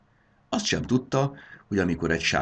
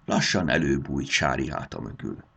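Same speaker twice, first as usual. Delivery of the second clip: ordinary delivery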